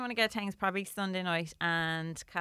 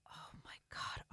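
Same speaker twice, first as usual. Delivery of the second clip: breathless whisper; 16 dB quieter, slower than usual. Real, not acted. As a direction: whisper it in awe. Whispered reply.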